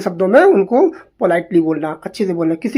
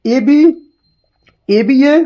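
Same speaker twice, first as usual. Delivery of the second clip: higher-pitched teacher's recitation; reciting aloud; 4 semitones higher, 3 dB louder, much slower than usual. Shown as a demonstration. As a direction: call out the rhyme scheme for students. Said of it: "मैं बोल दे रहा हूँ".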